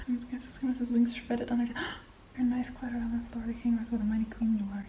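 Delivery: soft whispered read-aloud; hushed bedtime-style reading. Acted. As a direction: whisper the lines as you read.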